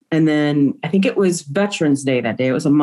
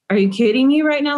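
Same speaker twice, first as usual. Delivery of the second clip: higher, quick exasperated retort; mock-incredulous, pitched up and hurried; retold incredulity; 8 semitones higher, faster than usual. Mother recounting her challenge to the detective, incredulous.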